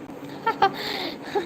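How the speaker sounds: high, soft breathy laugh. Real, not acted. Streamer amused by live chat, soft high laugh. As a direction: laugh softly, pitched high. Amused while chatting with viewers.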